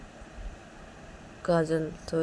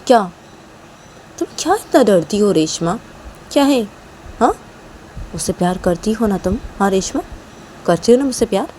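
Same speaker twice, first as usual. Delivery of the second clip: slightly louder pleading questions; coaxing Kajal; 4 semitones higher, 12 dB louder than usual. Acted. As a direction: coax her to admit it. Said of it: "तो काजल बोलती".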